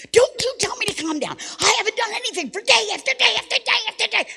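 high, loud full-out scream; screaming rage. Acted. Wife raging at husband who said calm down, furious screaming. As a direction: scream it like you have completely snapped. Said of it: "I started screaming and raging".

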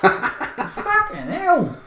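slow, soft and high mock Cockney; hammed-up Cockney with a laugh; Cockney accent. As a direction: drawl it in put-on Cockney. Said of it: "That is definitely Cockney".

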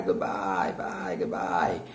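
low, slow, dragged-out goodbyes; put-on low voice, stretching each bye; mock fed-up farewell. Teacher acts bidding an annoying person farewell, low and drawn out. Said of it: "Aquela pessoa chata".

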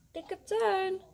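high drawn-out call for the cat. A narrator's reading. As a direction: call the pet, pitch lifted. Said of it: "roept hij dan".

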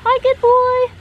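squeaky high praising voice; pet talk. Real, not acted. Praising the pet parrot, squeaky high pet-talk voice.

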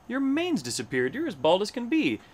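high, swooping astonishment; crying out. A narrator's reading. Nosy hornbill to bald Hubert, crying out. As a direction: exclaim like a scandalized gossip. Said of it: "she cried as she peeked in the tree".

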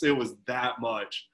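ordinary delivery

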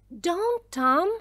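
high, urgent little protest; alarmed plea. Acted. Milly stops Tom entering the music room, alarmed plea.

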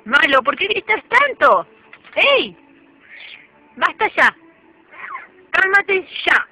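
loud firm telling-off; stern scolding. Real, not acted. Owner loudly scolding her screaming parrot. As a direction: clamp down on a screeching bird.

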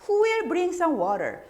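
high, slow, loud "who will bring some water?". Shown as a demonstration. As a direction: call out the question to the room. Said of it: "예를 들어서".

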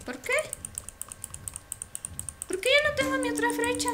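ordinary delivery

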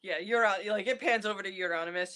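normal talking voice

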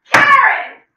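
very loud, high yell of a name; exasperated shout. Acted. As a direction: cut them off with a sudden shout. Scolds the one who keeps interrupting.

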